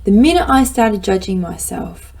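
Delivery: usual speaking voice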